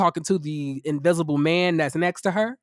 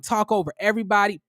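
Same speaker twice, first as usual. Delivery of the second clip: ordinary delivery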